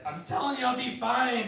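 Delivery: high, loud insistence; drunk grandma insisting. Retold or imitated. Drunk grandma refusing help from her grandson, high loud insistence. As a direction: brush them off like a stubborn tipsy granny. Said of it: "And it's like".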